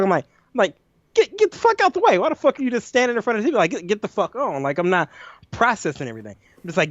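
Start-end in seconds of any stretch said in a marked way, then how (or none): 1.1-4.6 high, rushed exasperated outburst; high-pitched disbelieving rant at an intruder; frantic mock yell. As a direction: yelp it fast and high, voice jumping up. Acting out his panicked outburst at deer.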